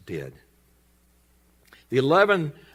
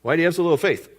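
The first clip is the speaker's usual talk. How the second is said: slightly louder, higher mock scolding; mild rebuke; 6 semitones higher, 5 dB louder, faster than usual. Jesus chiding sinking Peter, mildly exasperated. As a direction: chide him lightly. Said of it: "And Jesus goes".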